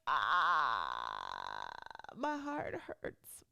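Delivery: long hammed-up groan, then slow lament; heartbroken wail. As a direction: moan it out in mock heartbreak. Laments the coach leaving.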